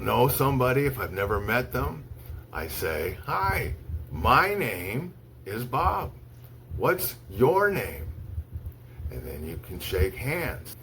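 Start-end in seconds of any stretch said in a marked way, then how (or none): 3.2-8.1 slow and clear, each word set apart; slow model greeting. Shown as a demonstration. Teacher shows students how to greet, slow and clear. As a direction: model the greeting slowly for learners. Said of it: "I'll show you how I make an introduction".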